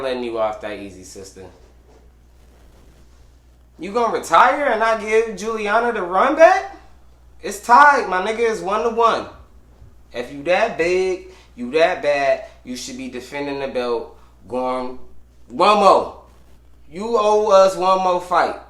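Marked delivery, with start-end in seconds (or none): 3.8-12.5 loud, high call-out; fired-up loud challenge; heated rant. Real, not acted. Ranting at retired champ. 15.5-18.6 loud demand. Real, not acted. Demanding a rematch.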